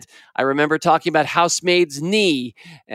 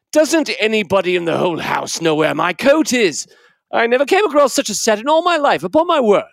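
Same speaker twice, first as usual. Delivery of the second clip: much higher, faster, indignant British uncle in a fluster; a put-upon uncle ranting at the whole household; exasperated uncle; 4 semitones higher than usual. Acted. Uncle rants about his lost coat.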